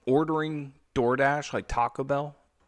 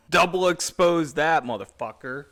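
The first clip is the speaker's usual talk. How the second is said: loud outburst; 4 semitones higher, 8 dB louder than usual. Real, not acted. Loud joke reacting to movie.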